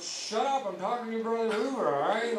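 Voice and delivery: loud, high mock yell; dad yelling. Father yelling at his son God, loud mock yell. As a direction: snap at the kid, loud.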